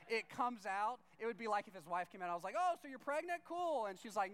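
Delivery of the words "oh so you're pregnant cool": high, loud, cheery blurting; pitched way up, overeager; mock eager blurt. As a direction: blurt it out far too brightly. Imagined awkward blurt to friend's wife.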